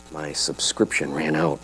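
deadpan under his breath; husband keeping it quiet; dry hushed reply. A narrator's reading. Husband answers flat, hushed.

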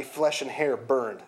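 plain reading voice

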